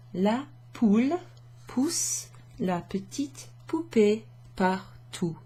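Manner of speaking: "La poule pousse la petite poupée partout" slowly, word by word; slow teacher's French with clear P sounds in "poule pousse"; word-by-word French demo. Shown as a demonstration. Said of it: "lots of P sounds".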